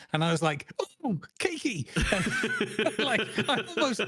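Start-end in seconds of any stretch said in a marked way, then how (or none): 1.0-1.9 much higher, delighted little squeal; giddy high-pitched cakey; euphoric cakey. Retold or imitated. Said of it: "I was in this euphoric state". 2.1-4.0 higher, laughing through the words; can't stop giggling while telling it; higher giggly retelling. Real, not acted. Giggling while recounting lunge.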